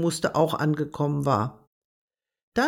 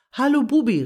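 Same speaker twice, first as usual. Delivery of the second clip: a friendly call, higher and louder; 7 semitones higher, 5 dB louder, faster than usual. A narrator's reading. Agathe calls up to Bubi in the tree, raised greeting. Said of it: "rief Agathe".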